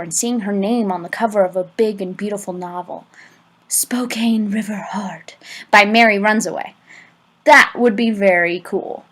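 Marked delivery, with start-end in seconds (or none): 3.7-6.7 1 whispered title. Pretend. Imagined book title, whispered dreamily.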